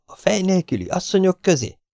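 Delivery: high-pitched, appalled questioning; horrified echo. A narrator's reading. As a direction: ask it high, aghast at the idea. Ahmed's horrified echo of the threat. (elszörnyedt, magas hangú kérdés)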